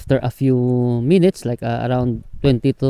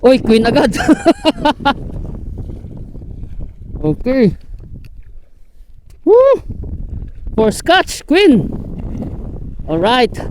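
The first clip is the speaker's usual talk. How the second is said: high, loud joyful whoops; excited whooping; 11 semitones higher, 3 dB louder than usual. Real, not acted. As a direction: cheer over the catch. Catch landed, whooping.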